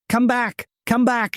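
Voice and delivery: high calling voice. Acted. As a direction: call after him, high. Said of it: "she called".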